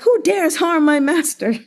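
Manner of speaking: high, loud mock outrage; hammed-up cry of a loyal servant; protective servant. Servant defending his master, mock outrage. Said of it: "he was like".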